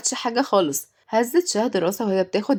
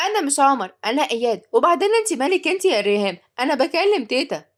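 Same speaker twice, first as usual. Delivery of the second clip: put-on cheeky boy; 6 semitones higher than usual. A narrator's reading. Boy snapping at maid.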